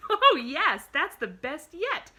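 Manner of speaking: high, loud, drawn-out glee; delighted crowing. A narrator's reading. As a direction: crow it like a thrilled child. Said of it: "she crowed".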